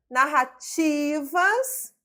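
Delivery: "Narrativas" with a long, high TI; stressed syllable demonstration. Shown as a demonstration. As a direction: slowly stretch the TI in "Narrativas". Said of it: "sílaba tônica".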